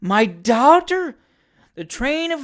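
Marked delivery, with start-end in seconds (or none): 0.0-1.2 loud, slow, high disbelief; shocked old lady. A narrator's reading. Old lady learning of her daughter's kiss, aghast. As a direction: draw it out, aghast.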